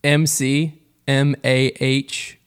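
slow letter by letter spelling; each letter spaced and deliberate. Acted.

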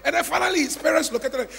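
ordinary delivery

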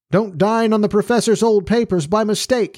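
high-pitched parting call; joking shout sent back, pitched well up; cried in parting. A narrator's reading. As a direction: call it out as you head off down the street. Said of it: "he cried as he vanished down the street".

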